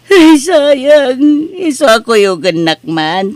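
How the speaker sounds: a loud, high cry of loss; tearful lament. Acted. Scammed mother laments her lost money to her husband, crying out. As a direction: wail over the lost money.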